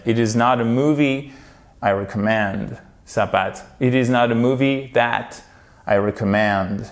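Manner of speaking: ordinary delivery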